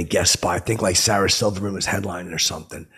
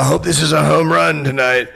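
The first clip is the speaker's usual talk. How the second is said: higher, louder, showy pun for the crowd; hammed-up stage hello; 6 semitones higher, 6 dB louder than usual. On stage, aiming his pun at Derek Jeter, hammed-up greeting. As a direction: sell the baseball pun big.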